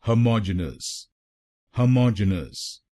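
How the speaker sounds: Homogeneous with the MOJ syllable hit; मौज पर जोर; stress on MOJ. Shown as a demonstration. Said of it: "दूसरे शब्दांश पर यानी एमओजी पर जोर डालना है".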